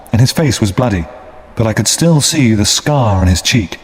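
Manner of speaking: ordinary delivery, nothing marked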